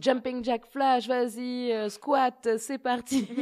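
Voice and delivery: high, loud workout call; mock fitness coach. Host teasing about jumps and squats, mock fitness coach. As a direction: call out the moves like an aerobics instructor.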